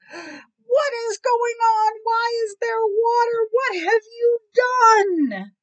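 high, loud, drawn-out wail; alarmed outrage. Acted. Cat wakes to water in boat, outraged. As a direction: cry out in horrified disbelief as the cat.

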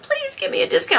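high, pleading little voice; a put-on begging voice asking for a discount; pleading request. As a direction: beg sweetly for the discount.

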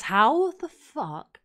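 slow, loud disbelieving swear; learning her grades, a slow loud disbelieving swear; stunned disbelief. Real, not acted. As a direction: drag it out in shock. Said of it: "Oh, sorry for swearing".

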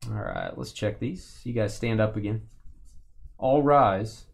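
ordinary delivery

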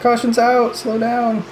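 calling out. Pretend. Calling to drivers at caution.

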